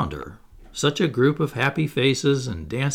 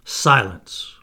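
firm, louder call for silence; cried out; 6 dB louder than usual. A narrator's reading. Wendy hushing the noisy children at table, crying out. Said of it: "Wendy cried".

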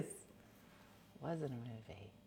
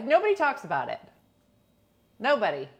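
ordinary talk

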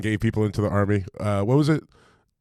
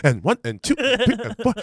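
laughing, high, mocking drill count; 12 semitones higher than usual.

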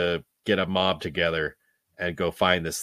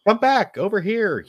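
high, louder retold holler; imitated yell; 13 semitones higher, 7 dB louder than usual. Podcast host acting out Henry yelling to him, imitated yell. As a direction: call out, acting the man yelling after someone. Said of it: "he's yelling to him".

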